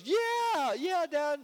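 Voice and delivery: put-on very high, excited little-kid voice; eager kid. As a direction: squeal it like a child keen to please Dad. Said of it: "is like a kid".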